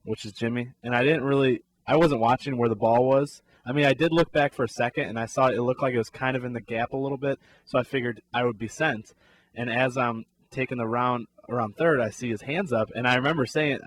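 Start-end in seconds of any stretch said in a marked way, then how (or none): none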